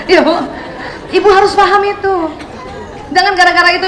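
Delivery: ordinary talk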